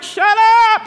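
high, loud mock snap of the banker; mock rebuke. Banker snaps at the begging heir, high and loud. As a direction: bark it out as the exasperated banker. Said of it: "he's like".

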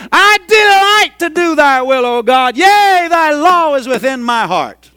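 full-voiced high holler to God. Preacher re-enacts his cry to the Lord in the car, hollering. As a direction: holler it out, high, drawn-out and loud. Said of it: "You holler at the top of your voice".

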